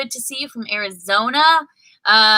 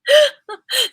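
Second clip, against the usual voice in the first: high laugh; 6 dB louder than usual. Real, not acted. Laughing at the end of the story.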